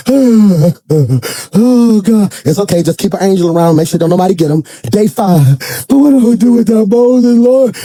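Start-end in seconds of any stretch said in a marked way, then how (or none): 0.1-2.3 acted grieving wail to God; mock crying. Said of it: "he's still out there crying". 2.4-4.6 low, easygoing voice of God; patient reassurance. Acted. God calmly minding grieving Joshua. 5.9-7.8 hammed-up grieving wail; mock crying. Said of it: "he's still out there crying".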